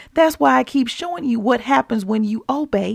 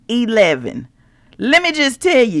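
usual speaking voice

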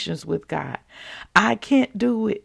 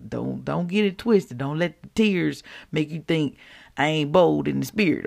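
ordinary talk, nothing marked

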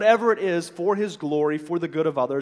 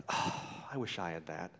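low, quiet wistful sigh; acted envy, voice dropped low; envious; 10 semitones lower, 12 dB quieter than usual. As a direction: sigh it out softly, longing for another's luck. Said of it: "looking with an evil, envious eye".

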